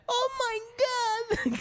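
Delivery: squeaky high wailing Oh my God; crying man imitation.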